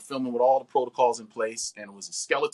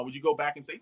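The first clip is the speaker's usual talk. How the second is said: ordinary talk, nothing marked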